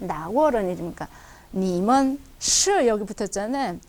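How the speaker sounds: normal talking voice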